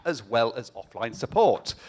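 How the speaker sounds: normal talking voice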